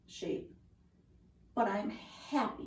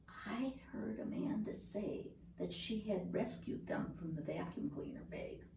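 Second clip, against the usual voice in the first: hushed, secretive whisper; 4 semitones lower, 12 dB quieter than usual. A narrator's reading. The tree confiding a secret to the listener, whispered.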